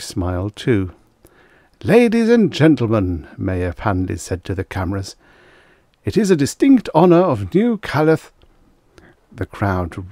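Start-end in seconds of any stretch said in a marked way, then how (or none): 1.8-3.3 high, loud ceremonial oratory; public address. A narrator's reading. Mayor addresses crowd and cameras. 6.0-8.3 high, loud ceremonial oratory; public address. A narrator's reading. Mayor's televised welcome speech.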